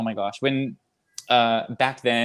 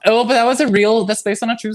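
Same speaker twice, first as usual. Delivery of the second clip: high and loud urgent correction; excited; 10 semitones higher, 6 dB louder than usual. Real, not acted. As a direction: jump in with urgency. Urgent correction about true story.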